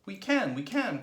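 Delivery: loud, high, eager insistence; insistent cry. A narrator's reading. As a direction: cry it out with conviction. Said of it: "cried Mugglewump".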